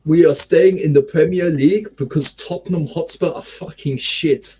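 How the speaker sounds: deliberate mock German accent, gloating at a rival; Daniel Farke impression. Leeds manager taunting Tottenham, mock German accent. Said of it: "You sound like Daniel Farke".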